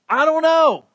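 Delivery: high-pitched admission of not knowing; acted cheerful helplessness; candid shrug. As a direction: throw it out high, conceding freely. Repeats modelled answer to challenger.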